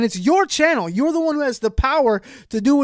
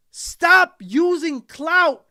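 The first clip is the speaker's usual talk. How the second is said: slow scolding; 9 semitones higher, much slower than usual. Real, not acted. Scolding the YouTuber.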